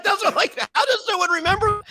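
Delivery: high, laughing retort through giggles; laughing disbelief. Retold or imitated. Telling a friend he can't believe nobody remembers, laughing high.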